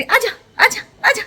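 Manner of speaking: put-on high, cooing baby voice calling out; imitating baby calling. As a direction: copy the little one calling mama to come. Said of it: "फिर मेरे को बुलाती रहती". (बच्ची की नकल में ऊँची आवाज़ में पुकारना)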